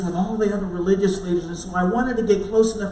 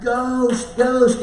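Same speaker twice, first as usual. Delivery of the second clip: mock scream of fright, the second louder; screaming ghost; 4 semitones higher, 5 dB louder than usual. Disciples in the boat, terrified, shouting ghost. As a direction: cry out as if seeing a ghost. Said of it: "we all screamed".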